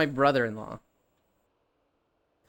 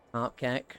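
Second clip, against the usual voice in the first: ordinary talk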